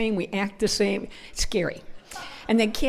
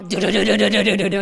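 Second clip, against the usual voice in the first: loud, rapid machine-gun stutter; imitated machine-gun fire; 8 dB louder, faster than usual. Hoods pull out their guns, mock machine-gun noise. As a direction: rattle off mock gunfire with the voice. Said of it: "pull out our machine guns".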